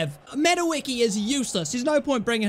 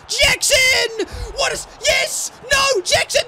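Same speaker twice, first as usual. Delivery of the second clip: very high, loud yell; real frantic outburst at a miss; frantic shout; 13 semitones higher, 5 dB louder, slower than usual. Real, not acted. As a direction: scream at the striker from hope to despair. Chance missed in front of goal.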